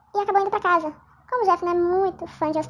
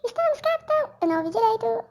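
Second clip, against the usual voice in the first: chipmunk voice; 5 semitones higher than usual. Pretend. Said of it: "agora eu tô com voz de esquilo".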